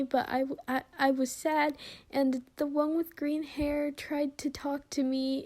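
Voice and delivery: put-on high, pleading child voice, near tears; a scared kid blurting out apologies; tearful little boy. Child confessing, sobbing apology.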